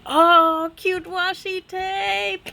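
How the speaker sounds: high, loud oh into slow coo; real high-pitched delight; delighted squeal. Real, not acted. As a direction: gush over something cute, drawn out. Delighted by cute tape.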